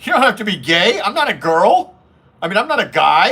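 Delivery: hammed-up high offended voice; indignant lady boy. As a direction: imitate a lady boy squealing in disbelief. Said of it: "They'd go".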